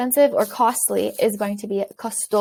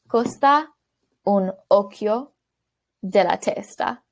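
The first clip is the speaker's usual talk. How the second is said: "Costa... un occhio... della testa", in separate chunks; slow model phrase. Shown as a demonstration. Teacher helping learners try this expression, slow model phrase. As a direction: pause between chunks so learners can repeat.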